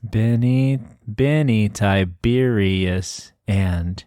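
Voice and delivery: very slow, syllable-by-syllable reading; metered sounding-out. Pretend. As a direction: stretch each beat as if testing verse. Fitting names into metered verse.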